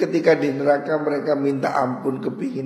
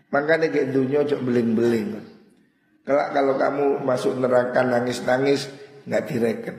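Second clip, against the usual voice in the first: usual speaking voice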